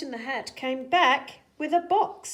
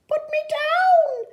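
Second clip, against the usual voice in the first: high, slow, loud fish plea; fish character voice; 10 dB louder, slower than usual. Acted. Fish held up high begs the cat, high and loud. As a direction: wail it from up in the air. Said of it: "said the fish".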